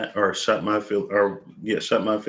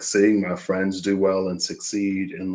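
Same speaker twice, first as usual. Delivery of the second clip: usual speaking voice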